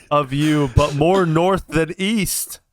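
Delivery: calling back. Acted. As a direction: draw the words out to carry across distance. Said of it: "can I yell back?".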